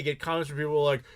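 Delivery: ordinary delivery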